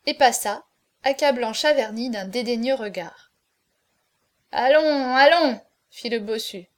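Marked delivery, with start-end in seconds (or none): 4.5-5.6 loud, impatient urging; raised call to get on with it; rousing call. A narrator's reading. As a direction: chivvy the company along loudly. Hunchback urges company to drink.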